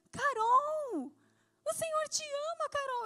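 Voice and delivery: high, loud and moved; emotional proclamation. Retold or imitated. Preacher telling the mother, high and tearful. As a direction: announce it with tears of joy. Tells mother God loves her.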